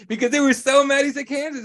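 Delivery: high, amused telling through laughter; words bubbling up with a chuckle; laughing. Real, not acted. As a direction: laugh as you recount it. Laughing while retelling the boos.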